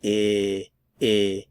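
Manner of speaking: I held alone like the i in is; short I vowel said on its own; isolated vowel I. Shown as a demonstration. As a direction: drill the short I sound. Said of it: "And then the vowel sound here".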